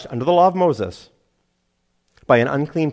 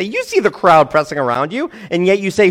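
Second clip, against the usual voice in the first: higher, louder, baffled by a dopey question; incredulous, raised-voice objection; incredulous disciples; 7 semitones higher, 6 dB louder than usual. Acted. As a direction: protest in disbelief, voice raised. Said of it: "thought it was a dopey question".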